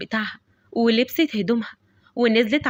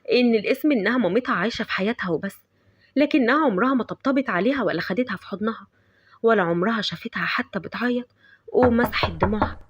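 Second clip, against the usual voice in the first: plain reading voice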